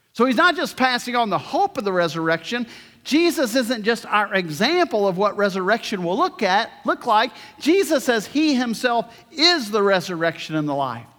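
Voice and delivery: ordinary delivery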